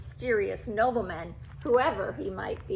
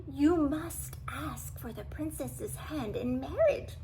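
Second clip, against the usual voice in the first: cunning whisper; 4 semitones higher, 3 dB quieter than usual. A narrator's reading. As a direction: whisper the plan into his master's ear. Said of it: "whispered the cunning cat".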